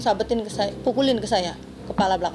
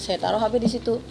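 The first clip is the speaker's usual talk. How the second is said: usual speaking voice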